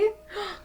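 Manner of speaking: sharp high intake of breath; startled gasp at the picture; surprised gasp. Acted. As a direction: gasp as if you just spotted it. Spotting a hidden gecko.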